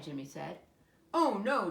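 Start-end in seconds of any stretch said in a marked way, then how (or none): 1.1-1.7 loud hammed-up gasp; mock alarm. A narrator's reading. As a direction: play at being scared. Dad plays along in game.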